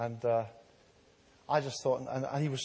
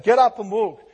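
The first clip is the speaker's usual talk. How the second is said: commanding call; 6 semitones higher, 12 dB louder than usual. Retold or imitated. Jesus commanding the paralytic.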